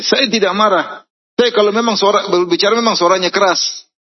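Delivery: angry denial. Retold or imitated. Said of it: "kata dia". (menyangkal marah dengan suara tinggi)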